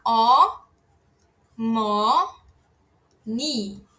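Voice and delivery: "ó, mó, ni" with long pauses between syllables; emphasised syllables. Shown as a demonstration. Teacher dictates the word for mother, separated syllable demonstration. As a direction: give "ó, mó, ni" one held syllable at a time. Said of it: "a gente vai enfatizar também".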